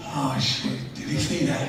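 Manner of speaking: softly, as if thinking to himself; hushed worried aside to himself; inner thought. Retold or imitated. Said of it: "And I'm thinking".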